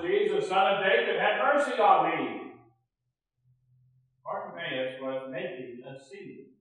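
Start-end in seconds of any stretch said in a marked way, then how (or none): 0.0-2.5 a beggar calling out for mercy, raised voice; calling out, louder. Retold or imitated. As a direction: call for help from the roadside. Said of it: "this yelling coming up the top of his lungs".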